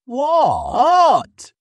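very high, loud astonished cry. Acted. Lion sees the monkey alive in the tree, astonished shout. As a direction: shriek it in disbelief. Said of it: "he cried".

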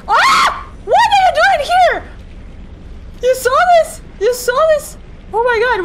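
high, loud frightened yells; startled shout. Real, not acted. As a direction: shout out in shock. Startled by creature in game.

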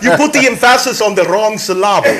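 ordinary talk

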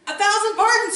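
high, loud piglet squeal. Acted. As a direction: squeal it like a frantic little pig. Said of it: "squealed two".

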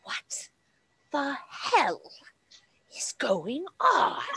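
whispered menacing snake woman; hissing snake woman. Acted. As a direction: hiss it through the dark like a serpent. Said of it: "she's like".

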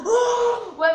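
loud laughing yell; laughing shout. Real, not acted. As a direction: burst out laughing loudly. Laughing outburst.

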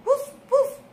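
high, playful puppy woofs; yappy little dog voice; puppy barks. Acted. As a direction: bark like a small happy puppy. Storyteller voices the puppy Biscuit.

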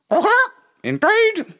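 hen voice. Acted. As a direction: chirp agreement like a hen. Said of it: "said the hen".

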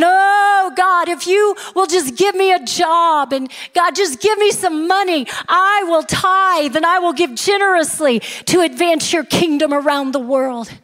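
high-pitched earnest-sounding mock begging; crying out to God. Broke man bargains with God for a job, mock pleading. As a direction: wail the opening, then plead up high. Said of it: "he's crying out to God".

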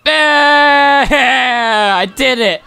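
high, loud burst of victory; triumphant cheer. Real, not acted. Gamer cheering after catching the last fish, excited. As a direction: shout it like you just won. Finally caught the tenth fish.

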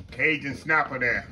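ordinary delivery

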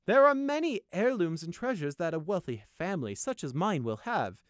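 high, loud, pompous noble voice; agreeing noble. A narrator's reading. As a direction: agree grandly, boasting of the family wealth. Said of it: "Cedar agreed".

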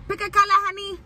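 high, sing-song nail tech voice; nail salon worker impression. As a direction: do the salon lady asking. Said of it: "I'm ready to hear".

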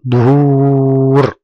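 دهور with a very long, level ū; long drawn-out vowel. Shown as a demonstration. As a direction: hold the و in دهور on one steady note. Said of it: "في المد بالواو". (مدّ الواو طويلًا في «دهور»)

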